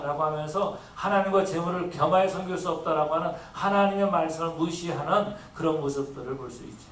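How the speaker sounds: ordinary talk, nothing marked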